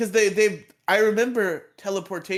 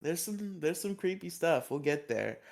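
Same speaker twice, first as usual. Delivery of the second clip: usual speaking voice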